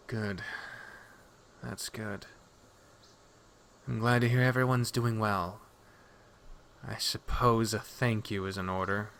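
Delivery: very soft, halting acknowledgement; subdued relief. Acted. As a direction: let relief settle quietly, with long pauses. Relieved Nadja gives reluctant thanks.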